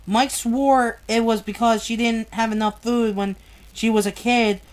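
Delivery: usual speaking voice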